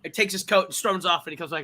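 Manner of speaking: ordinary talk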